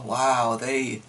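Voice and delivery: ordinary delivery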